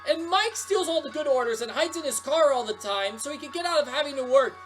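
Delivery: high, heated tirade; angry rant. Acted. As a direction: rage at the unfairness of it. Rant about unfair firing.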